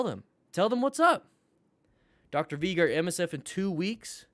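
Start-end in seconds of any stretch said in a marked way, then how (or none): 0.5-1.3 excited. Real, not acted. Hyping the crew up.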